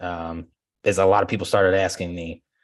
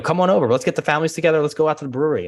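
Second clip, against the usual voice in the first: much higher, louder eager invite; client's invitation; 8 semitones higher, 4 dB louder, faster than usual. Retold or imitated. Client texting the agent to come over, much higher eager invite. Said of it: "I have several text messages where we keep".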